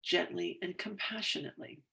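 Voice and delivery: tapping affirmation. Acted. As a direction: read it like a calm self-help script, easing off at the end.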